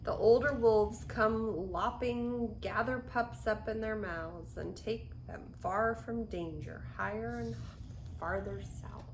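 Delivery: put-on gentle rhythmic read-aloud for children; storybook reading. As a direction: read the rhyme to little ones. Reads picture book aloud.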